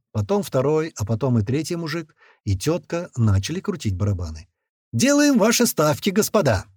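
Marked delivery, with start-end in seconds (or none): 4.9-6.6 high, showy croupier call; showman's raised-pitch announcement; theatrical announcer. A narrator's reading. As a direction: invite bets with a theatrical flourish. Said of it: "театрально разведя руки".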